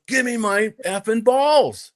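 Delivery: high, slow, stretched-out order; imitated angry demand. Golf tough demanding his balls back from a player, angry. Retold second demand from menacing golfer.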